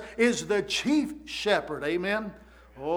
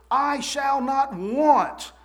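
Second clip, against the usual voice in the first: slow, raised, stretched-out proclamation; emphatic declaration; 4 semitones higher, much slower than usual. Retold or imitated. Preacher quotes David's conclusion to the congregation, slow and emphatic. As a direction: draw out each word at a higher pitch.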